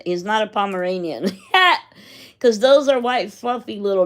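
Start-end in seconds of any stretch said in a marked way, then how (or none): 1.3-1.8 a sudden high laugh that bursts out; burst of laughter. Real, not acted. Laughing at mixing up dog breeds, a high burst of laughter. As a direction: laugh out loud at the mix-up.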